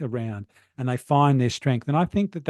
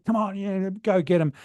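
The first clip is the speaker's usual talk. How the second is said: higher-pitched mock shout, kept down in volume; David Goggins; 6 semitones higher than usual. Coach imitates a shouting motivator urging people on, mock shout. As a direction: imitate a motivator yelling without really yelling. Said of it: "he's pretty out there and shouting".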